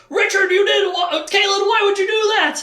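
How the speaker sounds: very high, loud yelp of reproach; mock outraged shout. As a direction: shriek the scolding in hammed-up dismay. Acted outraged reproach over prophecy.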